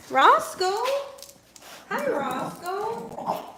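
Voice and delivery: high, drawn-out call to the dog; high sing-song hello to a pet; doting greeting. Real, not acted. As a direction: coo his name.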